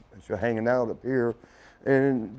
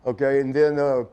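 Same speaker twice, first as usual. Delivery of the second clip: normal talking voice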